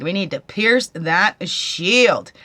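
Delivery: slow, loud, every word pressed; preachy emphasis on "pierce"; stress on pierce. Real, not acted.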